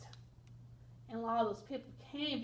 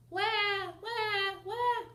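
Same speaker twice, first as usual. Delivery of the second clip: mock sad trombone; 9 semitones higher, 5 dB louder, slower than usual. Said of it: "sad trombone sound".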